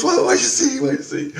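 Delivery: high, loud urgent question; alarmed friend. Retold or imitated. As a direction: press him for an answer, scared.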